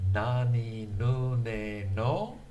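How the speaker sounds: Nani nu ne no read slowly; syllable reading. Shown as a demonstration. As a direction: read the kana row clearly. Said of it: "No surprises there".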